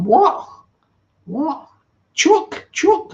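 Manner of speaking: high, loud mock Liverpool accent. Imitating chat guest's comeback after the accent slip, mock Liverpool accent. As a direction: put on a high-pitched Scouse voice. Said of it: "or whatever he's saying in Liverpool".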